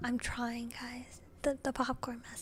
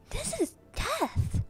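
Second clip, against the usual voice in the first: high, slow dramatic wail; milk it like doom; 13 semitones higher, 5 dB louder, much slower than usual. Real, not acted. Dramatic exaggerated reaction.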